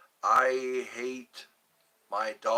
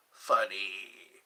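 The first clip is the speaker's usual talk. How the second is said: normal talking voice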